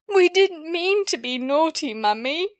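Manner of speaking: high, loud tearful plea; a child sobbing out an apology. Acted. Bobby sobbing her apology to Mother, high and loud. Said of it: "sobbed Bobby".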